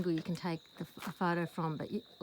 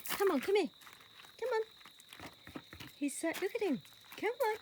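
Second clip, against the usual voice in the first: high coaxing; 10 semitones higher than usual. Real, not acted. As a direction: lure him in with a high voice. Coaxing the horse.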